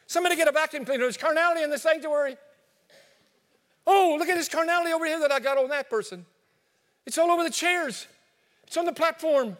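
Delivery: hammed-up panic over a mess in church; mock panic. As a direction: frantically call for help. Acted panic over mess in sanctuary.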